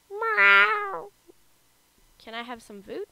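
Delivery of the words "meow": loud, high drawn-out meow; cat meow. Pretend. Player voicing a cat visitor, loud high meow.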